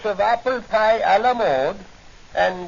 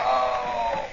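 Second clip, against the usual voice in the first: pained cry; 4 semitones higher than usual. Acted. Shot in the leg.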